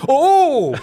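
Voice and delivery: hammed-up surprised gasp; mock startled yelp. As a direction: yelp as if caught off guard. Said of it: "he goes like".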